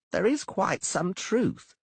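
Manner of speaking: high, brisk halfling voice; halfling Dainty. A narrator's reading. Halfling Dainty brushing off the poet's fears, high and brisk. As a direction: tease the poet in a quick high halfling voice.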